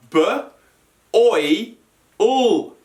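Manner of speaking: b, oi, ul said as separate sounds with pauses; sounds split apart. Shown as a demonstration. Teacher sounding out boil for pupils, sound by sound. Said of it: "the sounds of boil are".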